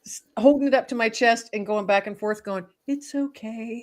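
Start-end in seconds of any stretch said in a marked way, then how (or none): 2.9-3.8 put-on soft, hushed comfort like rocking a baby; a light hint of lulling an infant; soothing baby talk. Woman cradling a stunned bird, softly soothing it. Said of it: "holding a baby".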